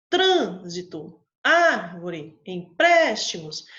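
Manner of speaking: Trânsito, árvore, empréstimos said loud and slow, each first-stressed syllable hit hard; slow, loud word list with heavy stress on trân, ár and prés; stressed demo words. Shown as a demonstration. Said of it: "na hora que eu pronuncio a palavra".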